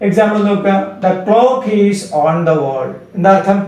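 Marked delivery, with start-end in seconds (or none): 1.0-2.9 slow dictation. Shown as a demonstration. Teacher dictates example sentence.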